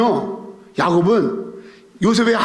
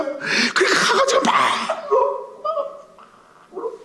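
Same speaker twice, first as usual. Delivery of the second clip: cracked, much higher sobbing words; breaking down in tears; 3 dB quieter, slower than usual. Real, not acted. Preacher overcome telling how Joseph wept, sobbing. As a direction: let the voice break completely.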